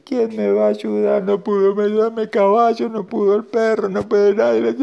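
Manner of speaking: high-pitched acted lament; tearful grandma. Grandma crying that nobody could help her, high wailing lament. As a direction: plead in a high, put-on crying voice. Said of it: "se quedó llorando la abuelita".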